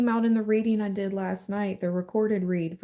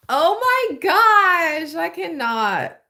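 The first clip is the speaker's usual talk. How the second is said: much higher, slow, stretched-out gasp of joy; overwhelmed; 10 semitones higher, 5 dB louder, slower than usual. Real, not acted. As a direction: draw it out in disbelief. Overwhelmed by the reading.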